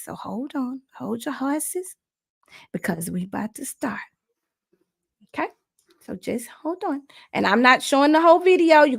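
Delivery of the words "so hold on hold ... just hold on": hushed, breathy-free whisper. Real, not acted. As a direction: whisper it, hold them back. Hushing viewers while setting up.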